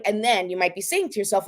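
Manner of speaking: ordinary talk, nothing marked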